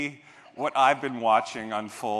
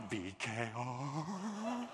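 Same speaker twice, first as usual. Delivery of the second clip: savoring the name; 2 semitones lower, 10 dB quieter, much slower than usual. Acted.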